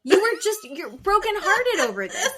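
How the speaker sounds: high, loud and insistent; pleading high and urgent; exasperated Heather. Retold or imitated. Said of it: "Heather's like".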